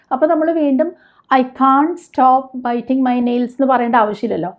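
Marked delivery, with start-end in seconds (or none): 1.3-3.5 drawn-out, word by word; slow model sentence. Shown as a demonstration. Teacher showing learners the English sentence, very slow. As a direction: dictate it slowly to the class. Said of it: "teacher models sentence".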